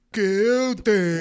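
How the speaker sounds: loud, dopey echo of the recording; exaggerated dim-witted mimicry; mocking dopey imitation. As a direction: parrot the slowed-down word mockingly. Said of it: "You can't put tard voice".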